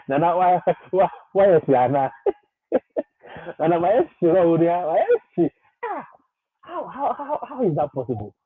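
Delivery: high, loud whiny mimicry of a demanding follower; mock whining demand. Mimicking followers demanding why Nana is absent, whiny and high. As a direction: whine it like an impatient fan, pitch climbing. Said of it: "The most annoying thing is the way they say".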